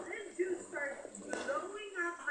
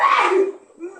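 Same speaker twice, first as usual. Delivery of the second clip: loud hammed-up wail imitating his crying; mock wailing; 2 semitones higher, 21 dB louder than usual. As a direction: belt out a mocking sob. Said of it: "this dude started crying profusely".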